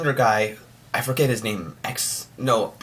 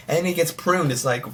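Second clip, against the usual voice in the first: usual speaking voice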